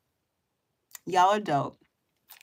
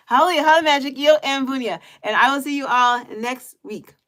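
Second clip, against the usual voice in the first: excited sign-off; 5 semitones higher, 6 dB louder than usual. Real, not acted. Loud sign-off to viewers.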